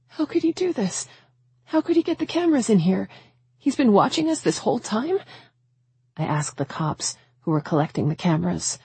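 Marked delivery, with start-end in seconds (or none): 0.1-5.2 high-pitched, upset questioning; distressed disbelief. A narrator's reading. Woman learns she has been watched, distressed disbelief. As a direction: voice lifted high in horrified disbelief. Mother horrified at hidden cameras.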